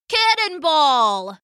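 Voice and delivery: high, drawn-out game call; crying out. A narrator's reading. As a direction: stretch it out like a kid leaping in. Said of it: "Will cried out".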